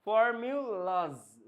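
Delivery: ফর্মুলাস slowly, ending in a buzzed z; slow for-miu-laaz for ফর্মুলাস; final z sound. Shown as a demonstration. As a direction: stretch ফর্মুলাস syllable by syllable, z for final s. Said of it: "জ".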